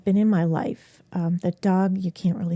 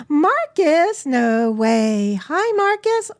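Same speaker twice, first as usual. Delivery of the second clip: excited greeting; 6 semitones higher, 6 dB louder, much slower than usual. Real, not acted. Greeting cat arriving on stream.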